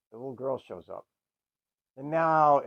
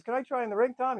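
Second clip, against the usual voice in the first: higher, lightly voiced sweet kid; little girl voice; 10 semitones higher than usual. Little sister greeting Tom, higher kid voice. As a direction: put on a chirpy kid voice.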